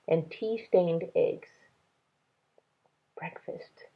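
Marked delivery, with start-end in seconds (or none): 3.2-3.9 whispered inner thought; thought to herself. A narrator's reading. Minley spotting food, a whispered thought. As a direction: breathe it like a private thought. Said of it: "Minley thought to herself".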